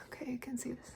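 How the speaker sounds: soft unvoiced whisper. Real, not acted. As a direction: murmur it while reading. Reading card text under breath.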